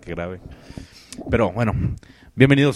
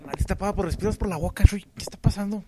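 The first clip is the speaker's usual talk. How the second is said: mock whisper; 11 semitones higher than usual. Jokes about the stuffy-nosed actor.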